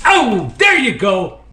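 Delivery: high, thrilled exclamation; excited reaction. Real, not acted. Reacting live to a snake strike.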